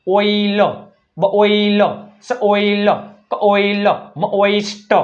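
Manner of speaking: "બ ઓઇલ" with the first consonant apart from OI; split-sound demonstration. Shown as a demonstration. Teacher drilling OI words, "સ ઓઇલ" sounded out in parts. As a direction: break "મ ઓઇસ્ટ" into consonant, then long OI. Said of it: "શું ઉચ્ચાર થાય? ઓય".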